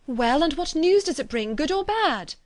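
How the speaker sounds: high, urgent question rising further at the end; eager questioning. A narrator's reading. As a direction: press papa impatiently for the answer. Elizabeth pressing father for news.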